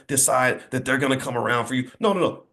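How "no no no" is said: usual speaking voice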